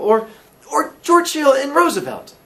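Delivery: high, loud, halting Billy voice; earnest, puzzled Billy. Acted. Billy explains linked names to landlady, puzzled.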